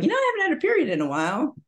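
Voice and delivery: high, rushed, offhand realization; sudden realization. Retold or imitated. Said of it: "And I was like".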